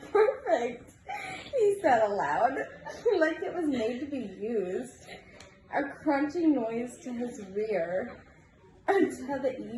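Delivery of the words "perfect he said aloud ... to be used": words half swallowed by giggles; giggling at the word. Real, not acted. Reader giggles through a story.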